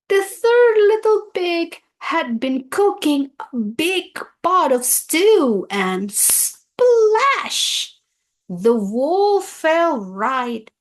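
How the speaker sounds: dramatic read-aloud climax; excited storyteller. A narrator's reading. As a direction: make the children jump at splash.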